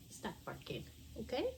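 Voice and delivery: hushing a pet. Real, not acted. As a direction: hush the dog gently. Scolding the dog.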